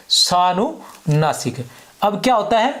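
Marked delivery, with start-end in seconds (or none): 0.1-1.6 सानुनासिक drawn out very slowly, sa-nu-na-sik; slow, syllable by syllable. Shown as a demonstration. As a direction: spell out सानुनासिक slowly for the class to note down. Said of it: "क्या कहते हैं?". (सानुनासिक को बहुत धीरे, एक-एक अक्षर खींचकर बोलें)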